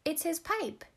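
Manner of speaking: put-on high-pitched, excited child voice; small girl's shout. A narrator's reading. Small sister giving away the prank, high gleeful cry. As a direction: pipe up like a gleeful little girl. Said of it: "shouted the small sister".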